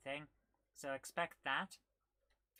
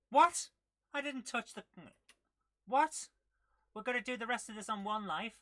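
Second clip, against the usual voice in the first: loud, high-pitched protest; indignant disbelief; 8 semitones higher, 10 dB louder than usual. Real, not acted. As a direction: burst out as if cheated by the game. Loses a life unfairly in game.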